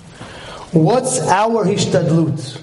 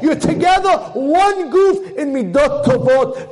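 high, proclaiming exhortation; impassioned preaching; 11 semitones higher than usual. Acted. Rabbi charging husband and wife at wedding, impassioned preaching. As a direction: exhort the couple with raised, ringing pitch.

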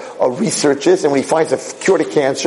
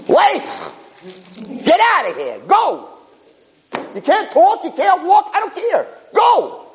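much higher, shouted orders; angry command. Acted. Hashem sending Moshe off, high angry shout. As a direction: drive him out the door. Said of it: "Hashem got angry at him".

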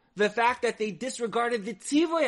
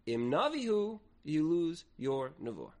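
ordinary delivery

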